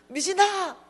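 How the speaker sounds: high-pitched call after a friend; acted-out insistent name call. Friend calls her again.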